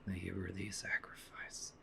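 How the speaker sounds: whispered guilty apology; hushed, guilty whisper; sad whisper. Real, not acted. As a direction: whisper it to the lost friend. Mourning dead game character.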